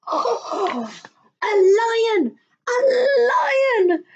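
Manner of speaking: put-on high loud child shout; terrified yell. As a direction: scream it, terrified. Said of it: "Neeraj yelled".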